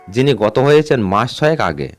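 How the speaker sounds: ordinary delivery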